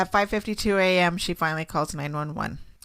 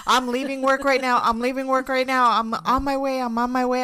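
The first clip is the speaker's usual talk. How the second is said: retold panic; 6 semitones higher than usual. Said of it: "And I'm like".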